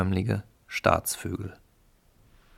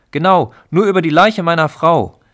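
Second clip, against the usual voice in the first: much higher, loud bluster; cowardly husband loudly backing his wife; 9 semitones higher, 11 dB louder than usual. Acted. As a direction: puff up and shout it proudly.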